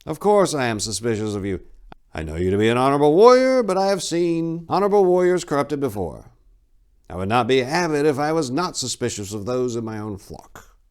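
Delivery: put-on the old abbot asserting his duty; stern abbot. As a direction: abbot warning Tor he trusts no one blindly.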